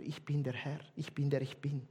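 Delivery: quiet solemn recitation; hushed reverent reading. Shown as a demonstration. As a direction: softly read as scripture, low and quiet.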